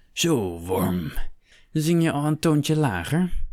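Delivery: sneering, rising high and loud; mock him from above; taunting brute. A narrator's reading. Cruel overseer taunts Miguel.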